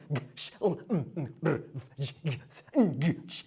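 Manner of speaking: g, sh, l sounded bare, no vowel; isolated consonant sounds. Shown as a demonstration. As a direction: say each sound alone like b, g, sh. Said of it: "it's gonna be".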